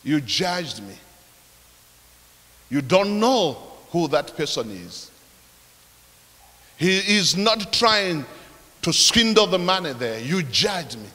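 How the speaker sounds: low, hushed, solemn voice speaking straight to the listener; voice from above. Retold or imitated. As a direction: quote an unseen voice's rebuke, soft and low, with long pauses. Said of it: "voice loud and clear".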